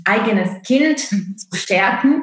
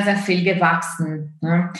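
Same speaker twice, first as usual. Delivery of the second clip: ordinary delivery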